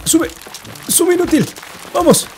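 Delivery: urgent urging. Real, not acted. Gamer urging his drowning character up, frantic and high. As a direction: push the character up, pitch rising in frustration.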